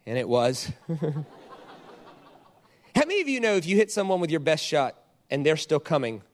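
ordinary talk, nothing marked

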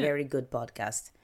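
a light mock Russian accent. Podcaster jokingly suggests a review in a light Russian accent. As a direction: read the words in a hint of Russian accent. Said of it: "I don't know what that Russian accent was".

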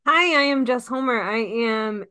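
ordinary delivery

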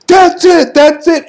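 high, loud mock outburst; fed-up referee. Referee throwing out the match, mock fed-up shout. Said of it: "The ref goes".

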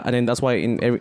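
ordinary talk

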